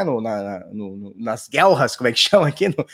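throat wet from a sip of coffee; after a sip of coffee. Real, not acted. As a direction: talk right after swallowing coffee. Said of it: "Deixa eu tomar um gole de café".

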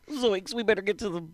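high cartoonish Shaggy voice. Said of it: "You should do voiceovers in the next Scooby-Doo".

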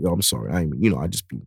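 low, soft mumbled sorry; imitated sheepish apology. Dad apologizing after getting too crazy, imitated sheepish apology. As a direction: back down quietly, pitch dropped. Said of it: "he'll apologize".

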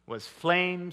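usual speaking voice

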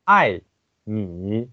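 我, 爱, 你 drawn out one by one; slowly shape each tone, pausing between words; slow pronunciation. Shown as a demonstration. Teacher demonstrating 我 爱 你 slowly, each tone distinct. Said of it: "其实可以慢慢的发音".

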